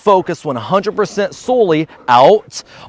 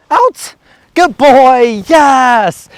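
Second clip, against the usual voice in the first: high, loud praise; bright burst of praise for the dog; excited praise; 8 semitones higher, 6 dB louder, much slower than usual. Real, not acted. Owner taking the duck and praising poodle, high and loud.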